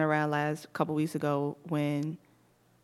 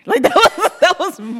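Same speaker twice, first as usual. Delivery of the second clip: usual speaking voice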